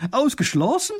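high emphatic denial; insistent. Acted. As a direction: brush off any doubt. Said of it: "behauptet die Frau".

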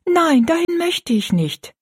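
high, slow pleading refusal; girlish protest. A narrator's reading. Rosalie refusing the workhouse to her stepmother, high protest. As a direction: plead in a high girl's voice, drawn out. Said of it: "sagte Rosalie rasch".

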